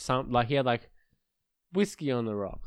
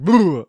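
disgusted bleh; 5 semitones higher, 12 dB louder than usual. Retold or imitated. Pulling faces at vodka.

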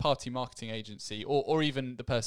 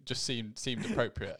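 ordinary delivery

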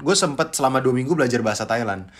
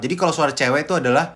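ordinary talk, nothing marked

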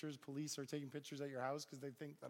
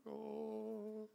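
high, drawn-out nervous "oh"; mock fearful held note; small high whimper of dread; intimidated oh; 8 semitones higher than usual. President gets in his face.

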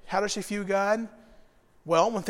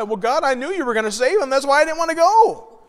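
grumbling Jonah; 5 semitones higher, 5 dB louder than usual. Retold or imitated. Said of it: "he starts to grumble".

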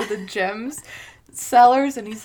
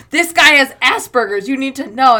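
loud, tactless yelled news; loud oblivious announcement; loud blunt announcement; 4 semitones higher, 10 dB louder than usual. Retold or imitated. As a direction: yell it in their faces.

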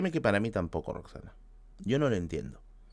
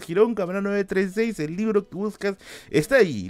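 higher, hammed-up sales patter; mock sales pitch; 8 semitones higher than usual. Defending himself to the bookseller with a hammed-up sales pitch. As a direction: sell it like a cheesy shop promo.

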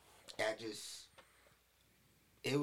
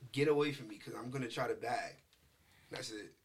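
normal talking voice

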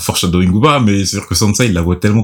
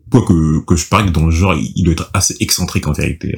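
usual speaking voice

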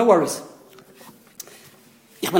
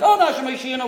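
lightly raised, imitating an excited crowd; excited congregational plea; 5 semitones higher, faster than usual. Teacher imitating the shul crowd at Hallel, excited. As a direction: mimic worshippers' eager cry with a modest lift in pitch. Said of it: "with excitement".

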